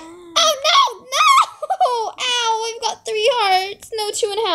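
squealing high through laughter; laughing panic. Real, not acted. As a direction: laugh while crying out no. Falling, hearts lost.

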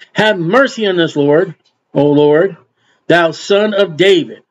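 ordinary delivery

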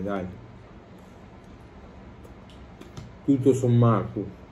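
chewing while talking; words between bites; mouth full. Real, not acted. Seen 0: bites flatbread at 0.1–1.8, then keeps chewing while talking.

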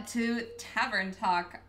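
normal talking voice

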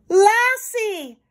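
high, loud cry for the dog; desperate call. A narrator's reading. Timmy calls his dog to save the doll, desperately. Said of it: "Timmy called again desperately".

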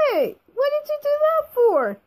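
high, loud indignant yell; outraged shout. Acted. Outraged at being hit.

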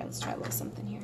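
whispered softly to herself. Real, not acted. Artist quietly whispers a plan to herself while drawing. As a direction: murmur it under your breath.

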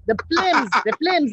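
mock Jamaican lilt on films; Jamaican accent. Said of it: "as Jamaicans say".

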